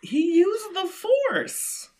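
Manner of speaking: mystical voice. Pretend. Mimicking Force mystical line.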